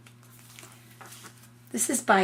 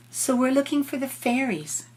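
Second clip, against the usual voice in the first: lightly voiced little girl; 6 semitones higher than usual. Lucy telling Turtle they can't sleep, light plaintive girl voice. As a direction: say it like a sleepy girl asking for help.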